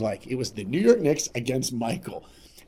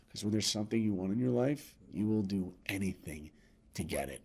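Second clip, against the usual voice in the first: fading to quiet; 3 semitones lower, 9 dB quieter than usual. Acted. Sincere lesson, fading.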